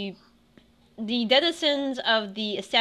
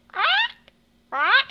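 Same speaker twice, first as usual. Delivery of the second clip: squawking animal; 6 dB louder than usual. Acted. Animal squawk in tavern scene.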